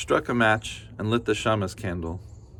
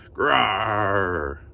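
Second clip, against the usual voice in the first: bear growl. Acted.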